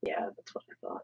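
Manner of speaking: soft unvoiced whisper. Real, not acted. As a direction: whisper it aside. Whispered aside.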